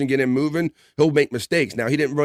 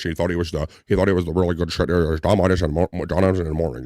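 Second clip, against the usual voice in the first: put-on a lightly lowered, old-school radio DJ voice; Don Imus impression; 6 semitones lower, faster than usual. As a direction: do a hint of a smug morning-show host who thinks he's the real deal. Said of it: "He kinda talked like this".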